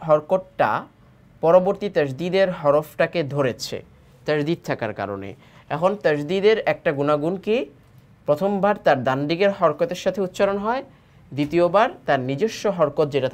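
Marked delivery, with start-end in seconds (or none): none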